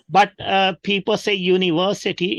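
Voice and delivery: ordinary talk, nothing marked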